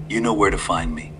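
lightly voiced old man, calm and sly; a hint of a courteous old stranger. A narrator's reading.

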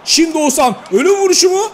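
high, loud rising call; thrilled commentator; excited build-up. Real, not acted. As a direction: build toward the finishing shot. Chance building.

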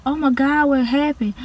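the janitor quietly stunned at the mess; hushed shocked janitor. Retold or imitated.

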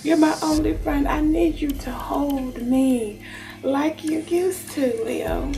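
slow, aching appeal to a lover; acted desperate clinging, drawn out; very emotional plea. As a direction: beg in a lover's role, stretching the words. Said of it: "they're very emotional".

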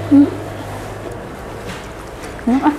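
humming with mouth full; talking between bites; chewing tofu. Real, not acted. Seen 1: bites tofu at 0.8-2.4, chews through hums, then talks.

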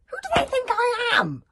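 put-on high, loud child protesting; a fed-up kid answering back; indignant kid. Child complaining about the parent: high, loud indignant. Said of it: "This is the kid speaking about".

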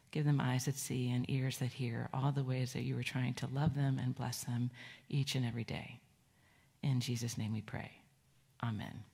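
soft, unhurried prayer voice. Acted. Praying for the children with heads bowed, gentle and soft. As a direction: pray gently over the kids.

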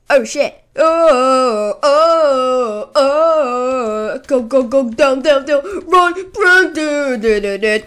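panicked loud yelps; real panic while fleeing; being chased. Real, not acted. Fleeing a monster in game.

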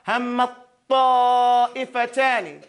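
"Hammat ta'ifatani" slow with the first t held into the second; doubled ta, slow. Shown as a demonstration. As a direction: drag "Hammat ta'ifatani," one long doubled t. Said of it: "you don't pronounce the ta".

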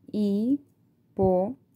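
slow, split into syllables. Shown as a demonstration. Teacher showing parents how to segment a word, slow and syllabic. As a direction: tap out each syllable of hipopótamo slowly. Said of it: "aquí yo doy el apoyo de".

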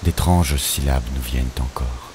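plain reading voice

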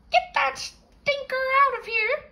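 high, screechy bird voice; squawky parrot. Acted. Parrot in the corner screeching high at Walter to get out. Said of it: "said the parrot".

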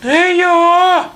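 very high squeaky mouse voice; mouse calling out. A narrator's reading. Mouse refuses to go to the mill, squeaky call. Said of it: "гукає круть".

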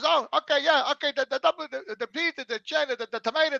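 high, loud listing worker voice; mock drive-through worker. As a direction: reel off the items in a high put-on voice.

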